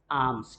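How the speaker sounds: आम्स said like mango aam plus s; आम्स with a long open a, no L. Shown as a demonstration. Teacher shows alms sounds like आम्स. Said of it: "mango आम होता है ना".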